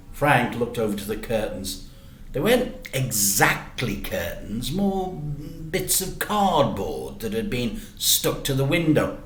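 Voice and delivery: ordinary delivery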